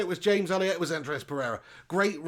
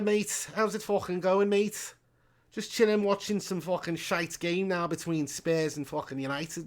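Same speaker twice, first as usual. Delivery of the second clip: exaggerated Liverpool accent, pitched up at first; jokey Scouse impression of a local lad; 4 semitones higher than usual. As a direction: put on a matey Scouser voice. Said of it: "Do a Scouser accent".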